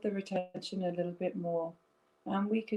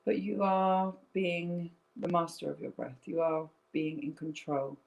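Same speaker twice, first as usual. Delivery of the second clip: guided breathing meditation; 3 semitones lower than usual. Acted. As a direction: lead them gently through each breath.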